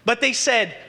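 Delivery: normal talking voice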